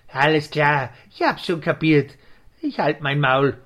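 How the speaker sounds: loud, low, huffy retort; cross giving in. Acted. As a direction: give in loudly and grudgingly. Scolded owl gives in crossly.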